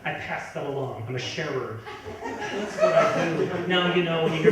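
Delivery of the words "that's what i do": high laughing aside. Real, not acted. Proud comic aside.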